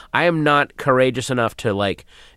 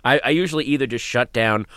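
usual speaking voice

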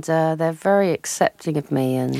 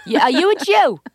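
Long Island housewife; 7 semitones higher than usual. Acted. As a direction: demand an answer, calling her darling. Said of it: "Yeah, Fern".

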